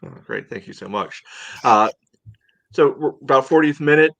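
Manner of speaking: ordinary delivery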